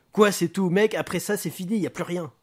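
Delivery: high, agitated comeback; worked-up gangster retort; indignant. Acted. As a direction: protest in outraged disbelief. Booker indignant at detective's calm.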